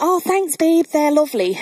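high-pitched polite fake delight; feigned gracious thanks. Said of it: "I was a really gracious person".

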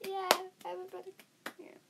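normal talking voice

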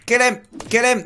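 high, eager call to finish the zombie off; egging the kill on; excited urging. Real, not acted. Gamer urging the kill on, eagerly.

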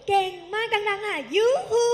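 high, loud yoohoo chant; loud cheer. Shown as a demonstration. Teacher urging class to say it loudly, high loud cheer. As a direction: shout it out with them.

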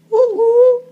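very high, loud cheering whoop; excited whoop. Real, not acted. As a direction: celebrate the pull out loud. Cheering a favourite mythic card.

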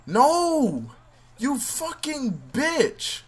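high, drawn-out furious curse; angry yell. Real, not acted. Gamer cursing the opponent who reversed him, angry. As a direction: spit it out slow and raging. Player yelling at the game.

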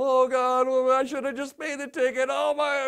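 hammed-up worrying in bed; mock fretting. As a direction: fret out loud like you can't sleep. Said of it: "tossing and turning".

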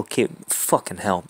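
ordinary talk

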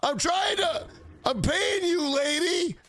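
high-pitched, hammed-up exasperated operator; distracted sex operator. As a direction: call out quiz guesses, mock-snapping at the caller. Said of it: "and she's like".

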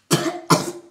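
breathy harsh cough; choking cough. Real, not acted. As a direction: cough from the burning taste. Coughing on cinnamon.

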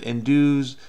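ordinary talk

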